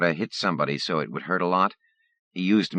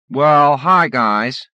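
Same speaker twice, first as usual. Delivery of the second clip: loud, high mocking hello; loud bully greeting; 10 semitones higher, 11 dB louder, much slower than usual. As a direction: call out like a bully. Bully greets them loudly.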